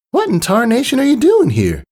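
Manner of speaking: high Southern filly twang; a surprised country girl asking; high, twangy filly asking her friend. A narrator's reading. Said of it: "Apple Bloom asked".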